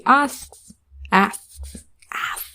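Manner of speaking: fading echo; echoing away into silence; echo effect. Pretend. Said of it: "repeated word as echo".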